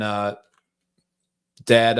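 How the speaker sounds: ordinary delivery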